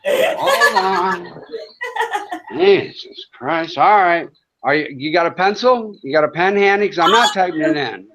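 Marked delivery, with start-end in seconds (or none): 0.1-3.8 breathy laugh through the words, drawn out slowly; can barely get it out for laughing. Real, not acted. Laughing at his own joke.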